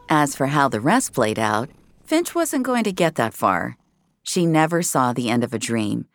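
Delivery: ordinary delivery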